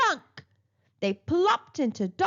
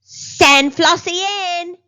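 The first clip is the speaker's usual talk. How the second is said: loud, high call through the office; a dentist calling the patient in; shouting; 7 semitones higher, 11 dB louder than usual. Acted. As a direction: shout it with false cheer. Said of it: "Doctor Dribble shouted".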